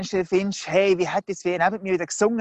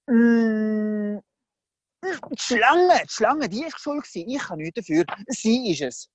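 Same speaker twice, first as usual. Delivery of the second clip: high, flustered mock accusation; hastily pin it on someone else; hammed-up excuse, starting high and easing down; shifting blame; 3 semitones higher, slower than usual. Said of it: "hat der schwarze Peter weitergereicht".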